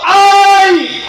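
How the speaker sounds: loud, high mock cry of anguish "ay"; great cry. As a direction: cry out "ay" like a tormented man. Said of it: "lanzó un gran grito".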